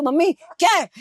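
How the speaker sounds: grumpy mother yelling back at her son; irritated mother. Retold or imitated. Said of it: "तो आपको आवाज दो".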